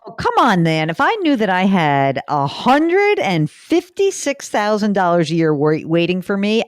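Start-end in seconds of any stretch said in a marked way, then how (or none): 0.1-5.5 incredulous. Real, not acted. Exclaims over caller's waiting pension.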